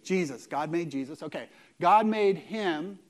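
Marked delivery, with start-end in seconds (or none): 1.8-3.0 slow reading. Shown as a demonstration. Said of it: "let's go together".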